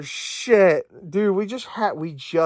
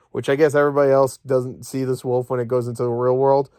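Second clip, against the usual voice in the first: normal talking voice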